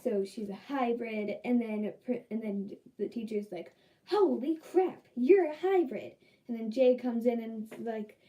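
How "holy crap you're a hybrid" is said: higher, louder, amazed exclamation; excited teacher. Retold or imitated. Teacher amazed, announcing the hybrid result excitedly. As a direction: gush over the hybrid news.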